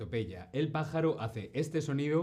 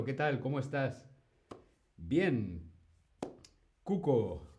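usual speaking voice

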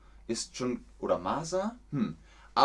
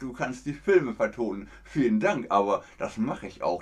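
ordinary talk, nothing marked

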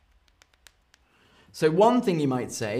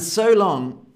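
loud, sweeping "so long"; dramatic; 5 semitones higher, 7 dB louder than usual. Shown as a demonstration. Teacher plays a film farewell, loud and grand. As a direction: say farewell like a movie hero. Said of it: "is quite dramatic".

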